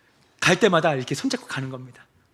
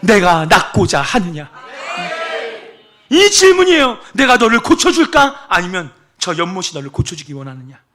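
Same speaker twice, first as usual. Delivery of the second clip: much louder and higher shouted sermon; fiery preaching; 9 semitones higher, 14 dB louder than usual. Acted. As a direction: proclaim it to the congregation with fire. Preaching at full force.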